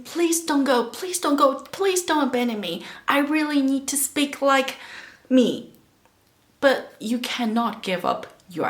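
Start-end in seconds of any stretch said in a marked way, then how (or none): 0.0-5.7 high, insistent mock plea; pleading old accent. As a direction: beg them to stay, pitched up and urgent. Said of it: "hold your tie and sing".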